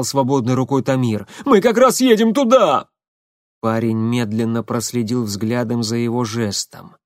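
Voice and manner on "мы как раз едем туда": испуганно. Acted. Водитель в панике.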